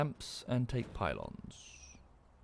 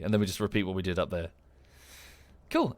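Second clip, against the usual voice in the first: usual speaking voice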